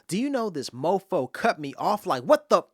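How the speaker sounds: mock road rage at the truck that cut him off; hammed-up outrage at a rude driver. Poem: truck cuts him off.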